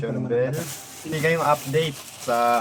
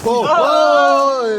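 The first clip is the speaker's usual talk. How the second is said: sudden startled yell; surprised shout; 10 semitones higher, 12 dB louder than usual. Real, not acted. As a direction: cry out in alarm. Surprised by collapse.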